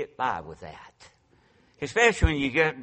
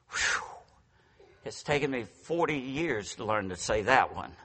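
ordinary talk, nothing marked

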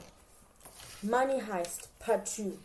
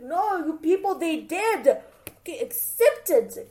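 loud, raised-pitch telling-off; emphatic retold argument, pushed louder; insistent scolding; 5 semitones higher, 8 dB louder than usual. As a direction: shut down their denial forcefully. Arguing back at sister and Kelly.

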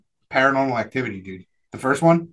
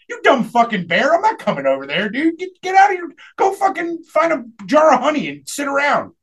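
higher, scornful mock yell; mock yelling at bear; 8 semitones higher than usual. Telling off the movie's talking bear, mock angry yell. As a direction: tell the dumb bear off, hammed up. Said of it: "I'd be like".

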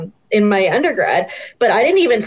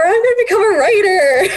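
laughing; 10 semitones higher than usual. Retold or imitated. Retelling announcement to parents.

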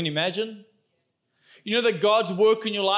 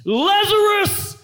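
much higher, loud, slow call; 12 semitones higher, 5 dB louder, slower than usual. Acted. Jesus calls loudly into the tomb, commanding shout. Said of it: "Jesus called in a loud voice".

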